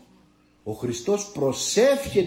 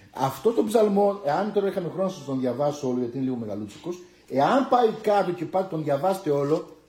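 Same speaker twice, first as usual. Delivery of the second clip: normal talking voice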